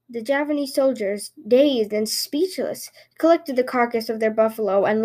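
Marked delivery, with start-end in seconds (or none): none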